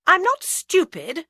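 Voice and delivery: slow, loud indignant retort; angry. A narrator's reading. Agatha answering Bill's warning, angry. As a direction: snap back, offended. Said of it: "angry now".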